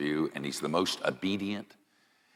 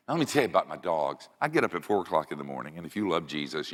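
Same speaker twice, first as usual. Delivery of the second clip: ordinary talk, nothing marked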